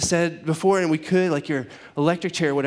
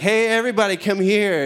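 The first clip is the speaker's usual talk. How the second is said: higher, louder call to a crowd; 7 semitones higher, 5 dB louder than usual. Retold or imitated. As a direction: holler everybody over, acted. Mimics Jesus calling the crowd.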